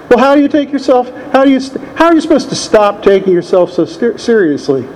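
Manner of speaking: incredulous plea. Retold or imitated. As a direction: mimic your younger self asking in disbelief. Retold plea to sponsor.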